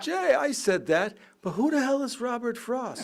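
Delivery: high, loud mock Yogi voice, laughing through it; high comic impression with a laugh in it; imitating Yogi Berra. Retold Yogi punchline.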